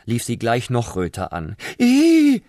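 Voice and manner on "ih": shrill piercing shriek, much higher and louder; scream. Acted. Prawn shrieking at the sight of the snail, piercing scream. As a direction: shriek in disgust. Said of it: "schrie sie".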